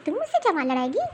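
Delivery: ordinary talk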